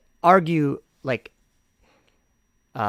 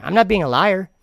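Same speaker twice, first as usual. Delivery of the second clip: indignant denial; 6 semitones higher, 6 dB louder than usual. Real, not acted. Accused of lying, denies it.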